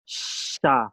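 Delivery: "sha" with a long hushing start; isolated syllable, hissing "sh" into short vowel; sibilant demo. Shown as a demonstration. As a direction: stretch the SH of "sha". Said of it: "in Sanskrit, you have the".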